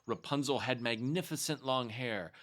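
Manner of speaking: plain reading voice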